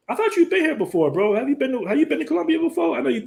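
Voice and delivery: wait, you haven't been here, bro?; surprised question. Real, not acted.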